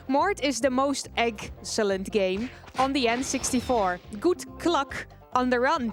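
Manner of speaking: ordinary talk, nothing marked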